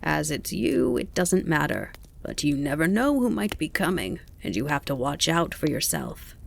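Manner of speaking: rasping grasshopper voice; rasped. A narrator's reading. Grasshopper warning the fawn to watch out, rasping. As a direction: caution with a dry rasp. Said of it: "the grasshopper rasped".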